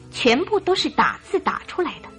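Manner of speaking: usual speaking voice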